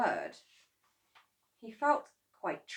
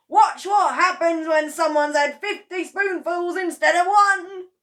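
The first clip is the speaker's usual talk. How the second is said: louder, higher shout of an excited husband; gleeful shout; 11 semitones higher, 13 dB louder than usual. Acted. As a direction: call out to George with glee. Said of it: "Mister Cranky shouted".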